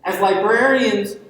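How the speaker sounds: usual speaking voice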